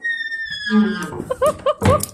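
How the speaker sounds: high squealing burst of laughter; shrieking laugh. Real, not acted. Laughing at her own creaking door, shrieking laugh. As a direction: squeal with delight at the noise.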